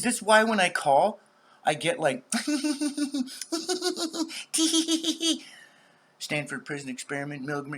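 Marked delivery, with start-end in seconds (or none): 2.3-5.6 a big high burst of laughter; loud laugh. Real, not acted. Vlogger cracking himself up, loud high laugh. Laughing at own joke about RCMP.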